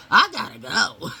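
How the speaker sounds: high, loud shout; brother-in-law fleeing the room; uncomfortable brother-in-law. Retold or imitated. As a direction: bolt out the door. Larry fleeing awkward scene.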